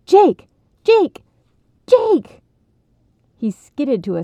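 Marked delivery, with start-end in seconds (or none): 0.1-2.4 high, loud excited calling out; excited kid calling a name; near shout. A narrator's reading. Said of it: "Ronnie nearly shouted his friend's name".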